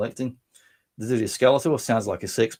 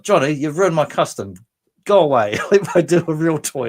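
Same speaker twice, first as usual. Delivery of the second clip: loud, higher-pitched playful telling-off; mock scolding; 5 semitones higher, 7 dB louder than usual. Viewer scolds Johnny over a ruined custom, mock outrage. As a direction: complain loudly, half in jest.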